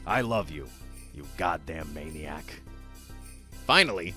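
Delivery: ordinary delivery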